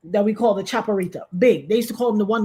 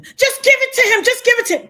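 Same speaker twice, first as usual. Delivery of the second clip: much higher, louder frantic plea; panicked cousin; 7 dB louder than usual. Retold or imitated. Cousin pleading during the robbery, high frantic voice. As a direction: beg her to hand it over. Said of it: "Hear my stupid play, cousin".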